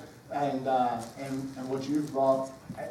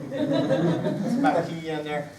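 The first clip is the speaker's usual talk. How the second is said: laughing; much slower than usual. Real, not acted. Joking about talking all night.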